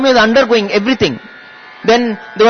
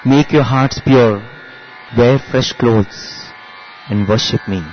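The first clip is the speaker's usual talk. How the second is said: solemn divine proclamation; 8 semitones lower than usual. Retold or imitated. Swami proclaims himself Sai Baba to the family, low and solemn. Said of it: "And that is when Swami says".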